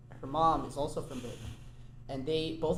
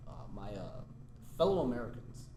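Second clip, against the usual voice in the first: Obama impression; 6 semitones lower, 4 dB quieter than usual. Addressing the nation, low mock Obama voice. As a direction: drop the voice low and pause like a president. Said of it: "you have to go lower, lower register".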